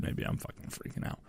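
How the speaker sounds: muttered almost voiceless aside; under his breath. Real, not acted. Host muttering to himself about being paranoid, barely voiced. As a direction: drop to a near whisper, half joking. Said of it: "Maybe I'm paranoid".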